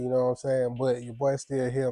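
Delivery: ordinary delivery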